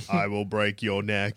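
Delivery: low, slow distorted hero voice; deep robotic voice changer; voice modulator. Pretend. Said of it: "That's what the voice modulator says".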